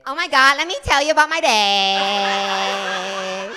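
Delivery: high, loud then slow drawn-out gush; gushing chatter. Retold or imitated. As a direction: gush into his ear like a chatterbox. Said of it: "I leaned in his ear, and I said".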